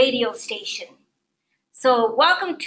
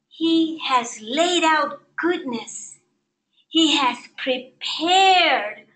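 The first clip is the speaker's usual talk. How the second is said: slow, every word weighed; deliberate slow preaching emphasis; slow emphatic preaching; slower than usual. Acted. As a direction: lean on each word, slowly. Preacher dwelling on scripture promise.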